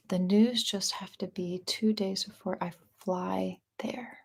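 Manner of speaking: secretive whispered repeat. Shown as a demonstration. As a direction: hush it into a slow whisper. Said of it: "Say that one more time".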